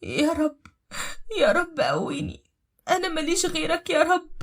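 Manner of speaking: high-pitched, pleading wail; crying out in pain. Acted. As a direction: beg God for strength through labour pains. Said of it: "كانت بتصرخ من الألم وبتردد". (استغاثة بصوت عالي الطبقة من الألم)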